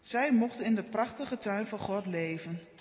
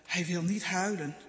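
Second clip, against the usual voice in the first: ordinary delivery, nothing marked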